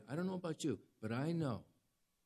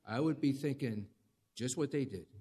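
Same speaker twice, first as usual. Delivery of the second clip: usual speaking voice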